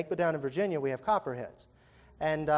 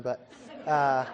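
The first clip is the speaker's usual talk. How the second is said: ordinary talk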